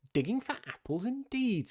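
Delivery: high-pitched indignant echo; cross rabbit, voice leaping up on "indeed"; angry. A narrator's reading. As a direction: throw it back in scornful anger. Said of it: "said the rabbit angrily".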